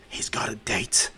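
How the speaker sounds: slow stage whisper; teasing whisper. Acted. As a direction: let her in on the secret, whispered. All Might confides the date.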